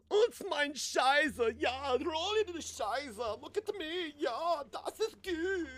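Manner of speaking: put-on high, slow, drawn-out German accent; stretched falsetto with hammy German accent; mock German accent. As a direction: leer in a cartoon German voice. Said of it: "In Germany, you're weird if".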